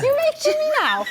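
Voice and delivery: laughing. Real, not acted. Nervous laughing protest.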